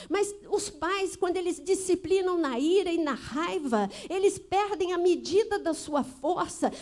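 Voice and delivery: loud, fervent sermon delivery; pastor's impassioned warning to parents, preached loud; preaching at full force. Real, not acted. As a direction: press the congregation hard about beatings.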